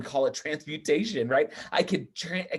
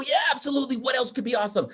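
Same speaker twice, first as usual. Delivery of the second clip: excited shout; 9 semitones higher, faster than usual. Retold or imitated. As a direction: cheer the kid's answer from the stage. Speaker cheering audience answer.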